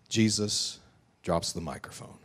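ordinary delivery

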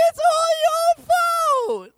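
very high, loud wailed accusation; mock screaming. Hurt believer running to God, mock crying and screaming. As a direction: scream it like a tantrum, hammed-up. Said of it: "crying and yelling and screaming".

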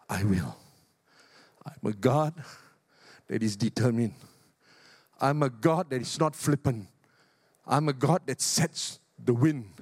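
low, grave God declaring. Acted. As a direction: speak as God, low and weighty. Preacher speaks as God.

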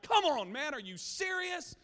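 high, loud retold exasperation; incredulous yelp, voice jumping up; mad outburst. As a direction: re-enact a sore loser's indignant protest. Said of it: "I got so mad".

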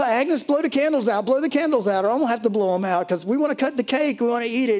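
high, hearty cook urging her. Retold or imitated. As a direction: play the jolly cook hurrying her. Cook voiced in story.

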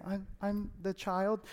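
small, soft and slow meek answer; innocent child. Retold or imitated. Son answering his mother, meek and quiet. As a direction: give in like a cowed little kid. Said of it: "very innocently say".

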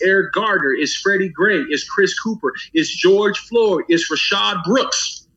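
slowed right down, each name weighed. Real, not acted. Closing list of victims' names.

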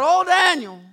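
loud anguished call from the king; crying out. Acted. King calling into the lion's den, loud and anguished. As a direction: shout it out, desperate. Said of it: "And he cries out".

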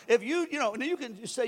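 ordinary delivery

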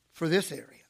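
ordinary talk, nothing marked

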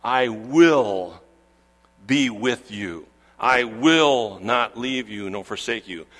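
slow, solemn pledge leaning on "will"; emphatic promise. Acted. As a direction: stretch each "will" with full weight. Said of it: "It's emphatic".

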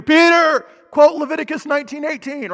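high, loud barked demand; yelling. Acted. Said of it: "He doesn't start yelling at Peter".

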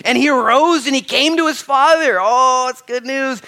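fiery preacher reading scripture; full-force preaching. Acted. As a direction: proclaim it to the whole church.

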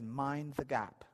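ordinary talk

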